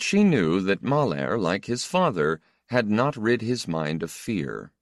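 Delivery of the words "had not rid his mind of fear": usual speaking voice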